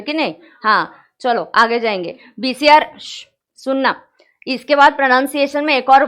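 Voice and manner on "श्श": short hushing "shh"; shushing. Real, not acted. Teacher quieting students before the next point, a shush. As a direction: hush the class with a shh.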